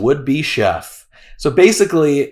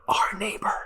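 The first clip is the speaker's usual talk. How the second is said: whispering; 8 semitones higher, 10 dB quieter than usual. Acted. Spooky hint about neighbor.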